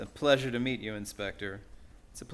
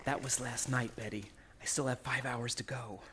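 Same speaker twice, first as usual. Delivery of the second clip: hushed, breathy whisper. Acted. Two fugitives hiding in the dark mill, whispering.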